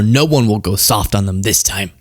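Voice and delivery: ordinary delivery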